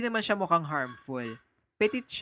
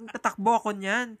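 ordinary delivery

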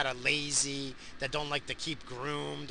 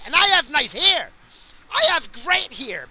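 indignant boast; 11 semitones higher, 12 dB louder than usual. Real, not acted. Boasting about his own hair.

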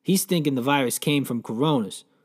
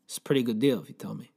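ordinary talk

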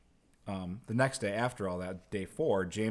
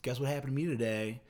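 higher-pitched imitation of a show-off; mock bragging; 4 semitones higher than usual. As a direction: lightly put on an annoying braggart's eagerness. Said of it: "bragging about it".

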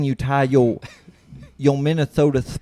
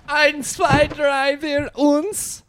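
put-on comic German accent, much higher; 14 semitones higher, much slower than usual. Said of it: "[name]'s German accent".

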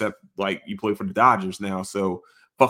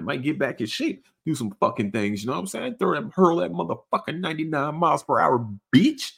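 usual speaking voice

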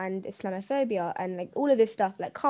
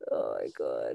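mock crying wail; 7 dB quieter than usual.

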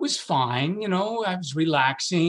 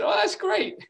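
delighted. Retold or imitated. Delight at interesting answer.

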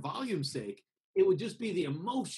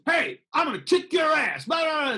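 high, loud aggressive shout; shouted threat; 12 semitones higher, 5 dB louder than usual. Shown as a demonstration. Threatening someone, a high loud shout. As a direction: yell it like you're about to swing. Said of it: "sounds like somebody that's ready to do it".

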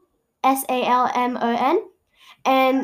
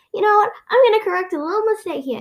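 higher, indignant retold retort; mother mock-mad; 7 semitones higher, faster than usual. Mother vowing to fix the dictionary, mock-mad. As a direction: play up a fed-up mother lightly. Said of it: "she got so mad".

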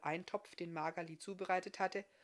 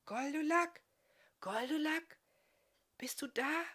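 higher woman's voice calling softly at a wagon at night; 10 semitones higher, 5 dB louder than usual. A narrator's reading. Woman outside the wagon calls softly to Goldulak, high hushed voice. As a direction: call his name gently from outside, high and hushed. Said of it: "eine weibliche Stimme vor dem Wagen leise rief".

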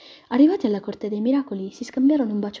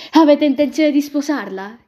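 higher and much louder; loud curious question; 6 semitones higher, 11 dB louder than usual. Acted. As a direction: call out the question. Chiese un'altra zingara.

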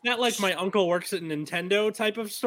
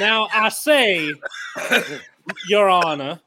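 hammed-up Southern defender addressing the judge; Southern lawyer accent; 2 semitones higher, 5 dB louder than usual. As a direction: play a drawling Southern attorney. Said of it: "I'm doing the Southern one".

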